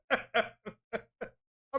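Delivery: laughing. Real, not acted. Laughs at own point.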